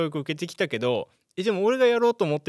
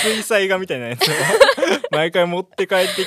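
louder, laughing through words; 6 semitones higher, 9 dB louder than usual. Real, not acted. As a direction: keep laughing as you speak. Still laughing.

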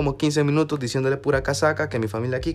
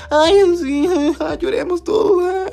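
much higher, hammed-up sobbing voice; mock weepy sentiment; 11 semitones higher, slower than usual. Student mocking a sappy retreat moment with hammed-up crying. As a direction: ham up a tearful love speech.

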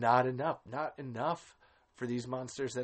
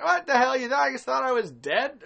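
Bert surprised to be alive; 12 semitones higher, 10 dB louder than usual. Retold or imitated. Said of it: "he comes back out and he's like".

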